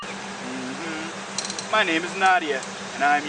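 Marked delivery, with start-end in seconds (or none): none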